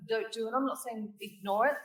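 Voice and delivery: ordinary talk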